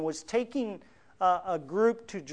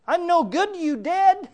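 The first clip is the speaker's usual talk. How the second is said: high, loud, imitated plea to God; hammed-up outcry, pitched way up; David crying out; 12 semitones higher, 9 dB louder than usual. Preacher voices David pleading with God, crying out. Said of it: "he cries out in a very practical sense".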